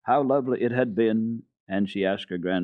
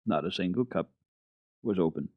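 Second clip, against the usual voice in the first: ordinary delivery, nothing marked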